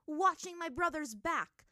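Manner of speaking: high, loud, drawn-out retort; fired-back retort. A narrator's reading. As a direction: shoot it back at an enemy. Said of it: "she shot at him".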